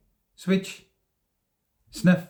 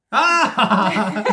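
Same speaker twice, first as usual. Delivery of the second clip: ordinary talk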